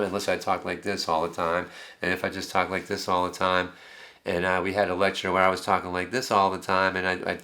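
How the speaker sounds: level tune with no inflection; monotone. Shown as a demonstration. Lecturer showing students speech without melody, in a monotone. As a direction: keep every word on one pitch. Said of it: "talk like this all the time with no change whatsoever".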